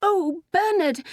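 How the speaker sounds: high, slow lovestruck sigh; fervent sigh. A narrator's reading. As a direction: sigh the name adoringly, pitched high. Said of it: "she sighed fervently".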